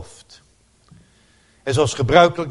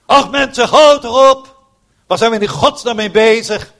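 high, loud exasperated appeal; exasperated outburst; 9 semitones higher, 7 dB louder than usual. Real, not acted. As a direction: plead with them to stop this. Preacher exasperated at congregation. (geërgerd en luid: hou toch op)

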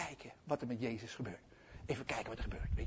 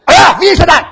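high, loud snapped question; hammed-up cranky shout; irritated demand; 10 semitones higher, 22 dB louder than usual. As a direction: yell back crossly. Grumpy Peter snaps back.

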